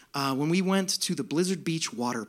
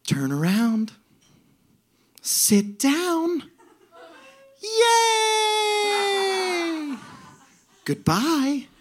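bright singsong with a big high "Yay!"; cheerful lifeguard; 10 semitones higher, much slower than usual. Retold or imitated. Comedian imitating the cheerful slide attendant to the audience, high joyful chirp. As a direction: chirp each step like the happiest woman alive. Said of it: "the voice of an angel".

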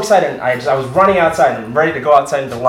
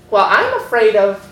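put-on high, hesitant old-lady voice; elderly nun; 7 semitones higher than usual. Old nun at the conference microphone, timid high voice. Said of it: "this old lady who's a nun".

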